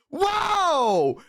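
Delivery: long, high-pitched re-enacted "whoa"; shocked whoa. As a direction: act floored by what is behind the curtain. Acting out shock at the porn.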